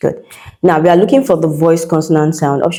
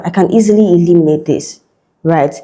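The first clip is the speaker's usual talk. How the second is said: ordinary talk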